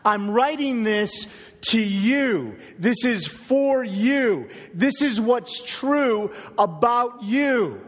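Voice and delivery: slow, with "for" and "you" stressed; deliberate preacher emphasis; emphatic appeal. Retold or imitated. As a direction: drive each short sentence home. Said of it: "Paul says".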